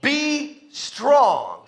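drawn-out, driving stress on "Be strong"; emphatic repetition. Real, not acted. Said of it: "let me say it one more time".

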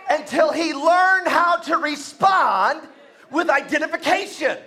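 shouted preaching, high and loud; preaching at full force. Acted. Preacher rousing the church, shouting. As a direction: build the congregation up at full voice.

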